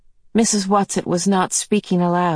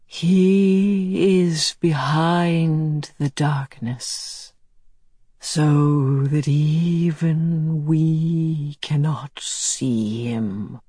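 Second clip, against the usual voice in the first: very slow, distant otherworldly voice; drawn-out ghostly words; eerie slow voice; much slower than usual. Acted. Mrs Which's eerie otherworldly voice.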